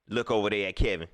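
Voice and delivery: ordinary talk, nothing marked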